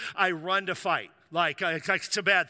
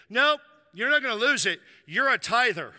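firm fatherly insistence; 5 semitones higher, 6 dB louder than usual. Retold or imitated. Quoting the father's firm reply.

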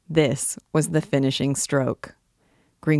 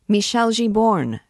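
higher, angry calling-out; angry cry; 6 semitones higher, 3 dB louder than usual. A narrator's reading. Angry poet to his motionless actor, crying out. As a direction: summon him sharply and crossly. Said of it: "cried the angry poet".

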